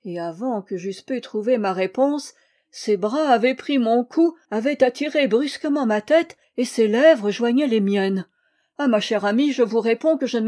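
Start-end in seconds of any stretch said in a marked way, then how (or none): none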